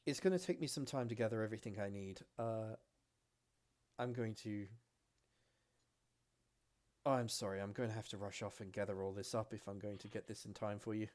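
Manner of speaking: fast, rushed apology; hurried elf girl. Acted. The elf girl rushes to gather supplies, talking fast. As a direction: rattle it off in a hurry. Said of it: "looks back to the two of you and goes".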